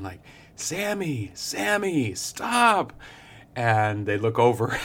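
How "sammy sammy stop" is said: high-pitched call after a runaway dog; calling a dog to stop, much higher each time; retold shouting. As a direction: shout the dog's name, pitch climbing high. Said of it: "this person sort of shouting".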